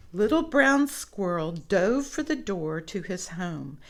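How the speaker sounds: plain reading voice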